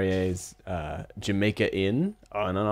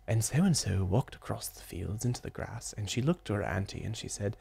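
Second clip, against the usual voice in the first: imitating audiobook narration; 8 dB quieter than usual. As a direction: hush it like a narrator reading the tale. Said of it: "every time he would be like".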